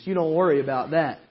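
normal talking voice

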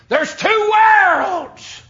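drawn-out high shouted line; preaching shout. Real, not acted. Preacher to congregation, slow high shout. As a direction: proclaim it to the whole room, slow and loud.

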